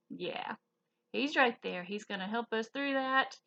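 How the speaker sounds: ordinary delivery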